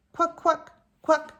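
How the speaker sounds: high quacking duckling voice; duck quack. Acted. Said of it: "The duckling waddles".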